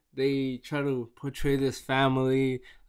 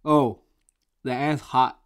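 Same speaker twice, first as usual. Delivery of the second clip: usual speaking voice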